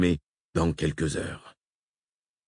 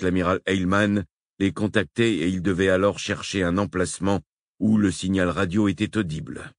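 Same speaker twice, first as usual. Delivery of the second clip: normal talking voice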